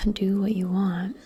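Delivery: usual speaking voice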